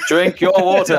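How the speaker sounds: high, insistent automated reminder; alarm voice. Pretend. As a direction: blare it out like a malfunctioning alarm. Acts out the alarm going off.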